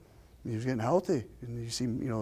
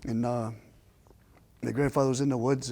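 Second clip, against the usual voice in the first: normal talking voice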